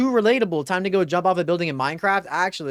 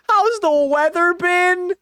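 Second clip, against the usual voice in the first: high, drawn-out disbelief; high slow dismay; 11 semitones higher, 4 dB louder than usual. Real, not acted. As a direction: moan the line slowly. Horrified at option.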